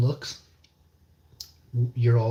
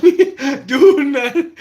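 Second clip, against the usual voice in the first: laughing; 12 dB louder, slower than usual. Real, not acted. Laughing mid-sentence while chatting.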